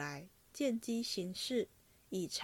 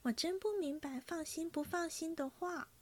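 put-on a young woman's coy insistence; 娇声坚持; insistent innocence; 7 semitones higher than usual. A narrator's reading. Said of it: "黛玉道".

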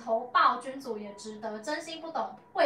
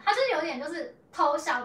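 usual speaking voice

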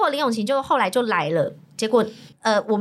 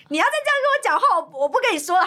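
mock huffy outburst, pitched way up; retold anger; 10 semitones higher, much faster than usual. Friend refusing to talk any more, mock angry huff. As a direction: imitate someone storming off in a high voice. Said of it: "他就生气".

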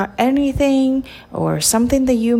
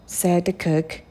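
plain reading voice

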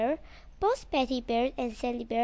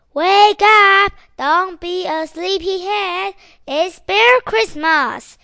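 high, very loud, slow at the end; shouting; 5 semitones higher, 15 dB louder, slower than usual. Acted. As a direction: boom it out to wake everyone. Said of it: "Santa Bears come and shouts".

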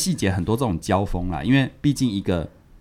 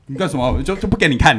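playfully guarding his grass, a bit louder; mock possessive refusal; 6 semitones higher, 4 dB louder than usual. Shown as a demonstration. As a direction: act like a kid hiding his treasure. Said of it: "来装一下".

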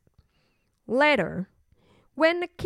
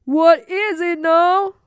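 loud, slow, raised question; exasperated king; 5 semitones higher, 8 dB louder, slower than usual. Acted. King called back by princess, exasperated. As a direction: answer a nagging child with loud exasperation.

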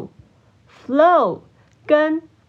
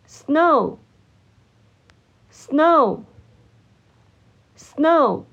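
snow said slowly, long pause after each; repeat-after-me drill; much slower than usual. Shown as a demonstration. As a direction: model the word snow for learners to echo. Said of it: "请大家跟我一起念三次". (跟读示范，一词一顿)

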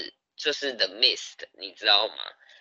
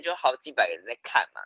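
normal talking voice

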